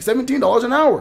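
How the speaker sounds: high, loud smug quote of the recruiter; a touch of a smug recruiter bragging; mocking his tone. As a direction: mimic a smug guy bragging about the pay. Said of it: "This is the exact tone he was using".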